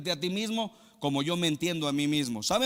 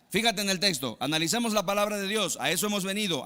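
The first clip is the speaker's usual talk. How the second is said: ordinary talk, nothing marked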